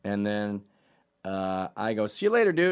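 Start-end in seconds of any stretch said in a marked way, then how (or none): 2.1-2.7 called-out goodbye. Retold or imitated.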